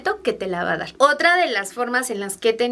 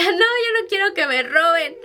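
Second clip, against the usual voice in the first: put-on higher, childlike plea; a little girl begging not to be taken; scared little girl; 7 semitones higher than usual. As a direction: play the frightened small girl refusing. Voicing her child self.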